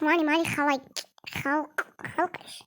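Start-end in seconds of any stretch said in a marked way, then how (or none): 1.4-2.6 breathless fast whisper. Real, not acted. Girl picking doll clothes, whispering fast to herself. Unsure what to dress her in.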